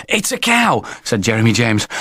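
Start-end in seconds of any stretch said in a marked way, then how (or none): 0.1-0.8 put-on high, out-of-breath boy; breathless, alarmed child; puffing and panting. A narrator's reading. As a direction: gasp it out after running. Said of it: "with a puff and a pant".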